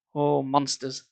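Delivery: ordinary delivery, nothing marked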